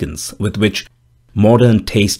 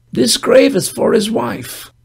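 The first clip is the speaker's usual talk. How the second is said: put-on old gravedigger voice; 13 semitones higher, slower than usual. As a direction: deliver it slowly with a dry grim edge. Gravedigger speaks in story.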